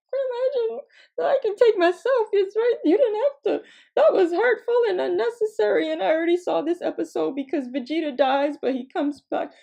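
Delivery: high, loud mock-child protest. Hurt child answering back to grandma, high-pitched mock protest. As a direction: plead your case in a high kid voice.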